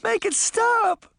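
very high, slow plea; high desperate cry. A narrator's reading. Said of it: "Sabrina cried".

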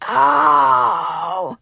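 loud, high groan of pain; pained moan. Acted. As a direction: moan like you just got hit. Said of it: "he moaned".

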